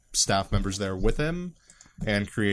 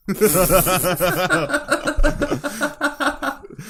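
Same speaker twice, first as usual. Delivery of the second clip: long high burst of laughter; high laughing fit; 6 dB louder than usual. Real, not acted. Podcaster laughing at the British accent idea. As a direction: dissolve into laughter.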